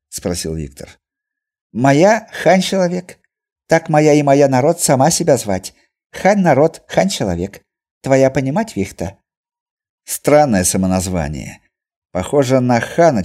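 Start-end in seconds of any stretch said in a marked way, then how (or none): 1.7-9.2 Chinese merchant voice. A narrator's reading. Merchant explains in broken Russian.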